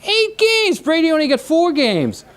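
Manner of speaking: very high, incredulous squeal; mock public disbelief. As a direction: squawk it like an aghast crowd reacting. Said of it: "Everybody says".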